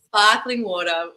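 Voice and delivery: "sparkling water" in Australian, R's dropped; Australian accent. Retold or imitated. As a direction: open Australian "ah" in "sparkling", "water" ending in a schwa. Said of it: "asking a waiter for a sparkling water".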